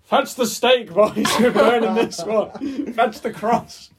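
put-on rally the boys to burn the stranger; hammed-up villager ordering a burning at the stake; mock medieval mob leader. Villagers preparing to burn him.

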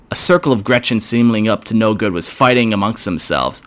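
ordinary delivery, nothing marked

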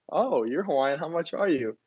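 ordinary talk

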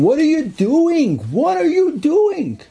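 high-pitched, drawn-out outraged challenge; arguing officer. Retold or imitated. Officer challenging SS men at the shul, high indignant argument. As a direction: confront them, slow and very high. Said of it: "They had a whole argument".

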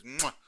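smacking kiss sound; chef's kiss. Acted. Host blowing a chef's kiss to the marketer, smacking kiss sound. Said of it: "Chef's kiss to you".

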